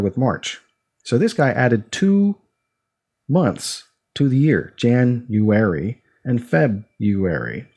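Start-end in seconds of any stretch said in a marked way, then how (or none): 4.8-7.7 January and February drawn out, broken into Jan-you-ary and Feb-you-ary; split-up month names. Shown as a demonstration. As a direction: say "January and February" slowly, in separated parts. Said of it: "people say it different ways".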